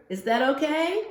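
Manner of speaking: ordinary delivery, nothing marked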